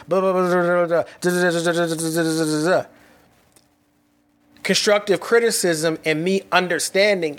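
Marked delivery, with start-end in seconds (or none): 0.1-2.8 rapid monotone run of nonsense syllables; imitated nervous babbling. Insecurity had me on this podcast, rapid mock babbling. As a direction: mimic your own insecure, nonstop podcast chatter. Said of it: "had me on this podcast".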